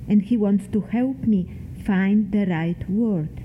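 usual speaking voice

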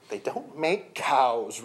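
slow, sniffy reply; sniffing. A narrator's reading. Risei explaining her missing cow amulet, sniffing. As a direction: say it slowly, with a sniff. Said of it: "Risei sniffed".

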